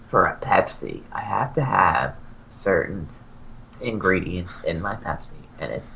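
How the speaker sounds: Dan's voice. Retold or imitated. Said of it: "I'm gonna do his voice".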